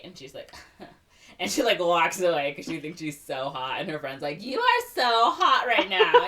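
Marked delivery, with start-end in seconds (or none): 4.4-6.3 much higher, louder, slow gush; mock gushing friend. [name] gushing to Ariel, high loud slow mock adoration. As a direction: swoon over her like a fangirl.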